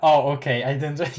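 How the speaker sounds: ordinary delivery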